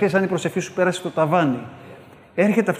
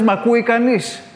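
calling out; 6 semitones higher, 3 dB louder than usual. Retold or imitated. Ancient Israelite calling to heaven.

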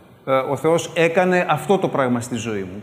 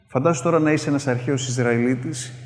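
ordinary talk, nothing marked

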